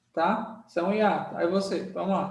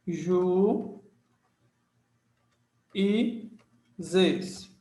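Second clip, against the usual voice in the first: juízes split into "Ju... e... zes" with long gaps; each syllable of juízes drawn out separately; much slower than usual. Shown as a demonstration. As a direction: sound out "Ju e zes" one syllable at a time. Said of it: "Separação silábica".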